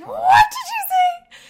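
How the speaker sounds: high, loud, slow scandalized squeal; hammed-up outrage at a bad word; mock shocked parent. Mom mocking the reaction she held back from her son, squealing. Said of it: "trying not to be like".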